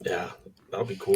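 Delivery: ordinary talk, nothing marked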